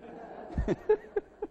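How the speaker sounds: breathy unvoiced high laugh; incredulous laugh. Boy hearing the order to jump, disbelieving laugh.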